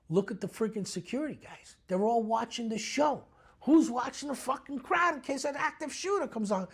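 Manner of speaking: worked up, pitch climbing high; agitated rant. Retold or imitated. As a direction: gripe with rising alarm at lax security. Retelling his rant at a concert.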